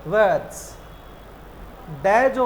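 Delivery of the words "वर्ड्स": "वर्ड्स" as "vets", no R, falling; silent R. Shown as a demonstration. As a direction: drop the R in "वर्ड्स", vowel straight into "ts". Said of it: "इसलिए इसको क्या बोलते हैं?".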